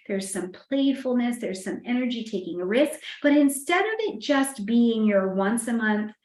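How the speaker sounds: usual speaking voice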